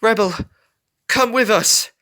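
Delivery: high, loud plea; pleading. A narrator's reading. Scarlet begging her brother Rebel, high pleading call. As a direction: beg him to come along. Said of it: "Scarlet pleaded".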